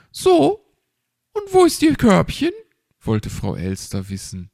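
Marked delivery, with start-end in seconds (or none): 0.1-2.5 chirpy magpie. Acted. Frau Elster asks the owl.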